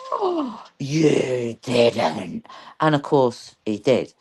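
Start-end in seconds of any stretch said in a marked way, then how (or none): 0.1-2.5 mock horror. Host reacting to the replay, mock shocked. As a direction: act out shocked disbelief, drawn out. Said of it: "I'm horrified sometimes and shocked".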